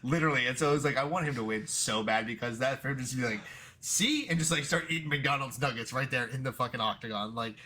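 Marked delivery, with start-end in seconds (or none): 3.8-4.3 triumphant mock Irish. Imitating Paddy triumphant.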